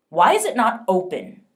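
loud, sharp demand; officer demands the coffin be opened, loud. A narrator's reading. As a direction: insist on an answer, forcefully. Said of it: "he demanded".

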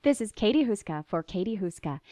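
usual speaking voice

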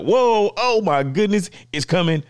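high, loud retold amazement; excited reaction. Retelling the reaction to the surprise announcement, excited.

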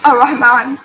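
ordinary delivery

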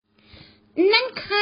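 scared, very high and drawn out; a frightened boy calling into the dark; quavering, terrified question; trembling voice. A narrator's reading. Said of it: "ร้องถามด้วยเสียงสั่นๆ".

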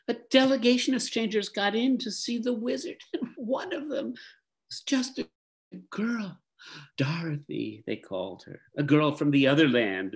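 put-on high, eager teenage boy. A narrator's reading. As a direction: voice young Lear, breathless and high. Boy Lear telling the news.

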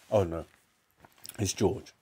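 ordinary delivery, nothing marked

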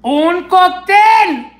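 loud, high, drawn-out delight; thrilled announcement. Real, not acted. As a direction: stretch it out in excitement. Thrilled by food sticker.

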